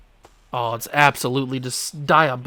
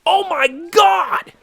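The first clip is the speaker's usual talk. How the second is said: frustrated shout; 13 semitones higher, 7 dB louder than usual. Real, not acted. Gamer striking out on an easy pitch, frustrated shout.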